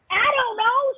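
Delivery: high, lightly voiced helpless answer; woman's high reply. Woman voicing the wife who can't explain herself, high and helpless. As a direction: pitch it up, a small lost shrug.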